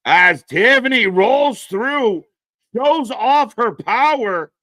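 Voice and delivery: loud, high, drawn-out wrestling call; excited play-by-play. Real, not acted. Watch-along host calls Tiffany's power move, excited play-by-play.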